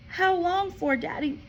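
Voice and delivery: a small anxious question; worried girl. A narrator's reading. Matilda asks her father anxiously. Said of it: "Matilda cried".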